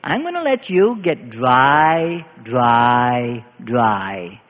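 God. Acted. God warning the church, slow and sinking low. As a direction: stretch the words out, dropping deep on each dry. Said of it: "God is saying to the church".

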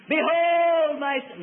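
booming, drawn-out proclamation, pitched high; voice from heaven. Acted. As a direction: call it out slowly like God from the sky. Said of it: "an audible voice out of heaven".

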